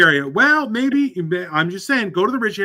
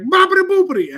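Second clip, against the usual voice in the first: high-pitched mock-Italian gibberish; freaking-out fake Italian; 13 semitones higher than usual. Retelling a cartoon man freaking out, high mock Italian. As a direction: squeal out cartoon Italian babble. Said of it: "the guy is freaking out in Italian".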